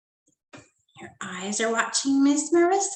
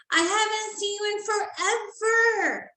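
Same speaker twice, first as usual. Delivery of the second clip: much higher, excited cry; 10 semitones higher than usual. Acted. Girl greeting her returning friend, excited high cry. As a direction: burst out with joy at seeing him. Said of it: "exclaimed excitedly".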